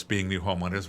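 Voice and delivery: ordinary talk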